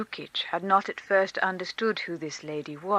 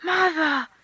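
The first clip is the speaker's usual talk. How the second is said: put-on high, glad child's cry; a small boy waking to his mother; 9 semitones higher, 6 dB louder than usual. A narrator's reading. Sleepy boy greets his long-lost mother, high and glad.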